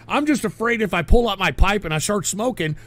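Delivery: usual speaking voice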